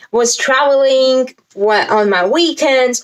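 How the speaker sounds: usual speaking voice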